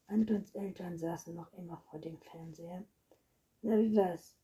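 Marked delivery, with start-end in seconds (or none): 3.6-4.4 loud, higher question; calling out. A narrator's reading. Father in front of the TV to his son, calling out. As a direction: call the question across the room. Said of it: "rief der Vater".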